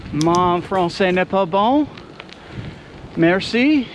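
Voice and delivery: slow English-accented French; foreign accent in French. Shown as a demonstration. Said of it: "see if I can practice saying".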